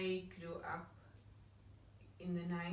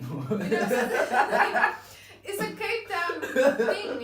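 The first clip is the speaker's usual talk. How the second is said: laughing; 7 semitones higher, 15 dB louder, much slower than usual. Real, not acted. Laughing after her singsong impression.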